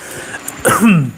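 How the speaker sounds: rough throat clear, then apology. Real, not acted. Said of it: "excuse me".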